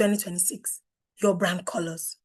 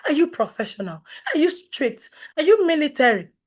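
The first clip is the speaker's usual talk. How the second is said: ordinary talk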